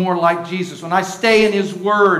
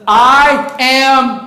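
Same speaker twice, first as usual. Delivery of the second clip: loud call, much higher and louder; leading a shout; 6 semitones higher, 9 dB louder than usual. Shown as a demonstration. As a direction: shout it back to them. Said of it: "See, you're yelling now".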